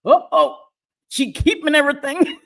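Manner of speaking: high, loud mock alarm; mock alarmed onlookers. People reacting to her owning a hard drive, mock alarmed. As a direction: imitate people sounding the alarm. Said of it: "People be like".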